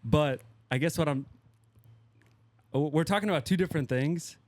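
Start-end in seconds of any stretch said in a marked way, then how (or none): none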